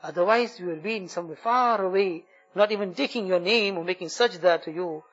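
fervent supplication, rising on each plea; heartfelt prayer. Acted. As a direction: pour out the dua to Allah, pleading. Leads an emotional dua.

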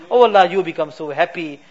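soft, low pleading prayer with fading endings; preacher closing in humble prayer; heartfelt dua. Acted. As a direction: beg for mercy, voice sinking on each Ya Allah. Closing supplication to God.

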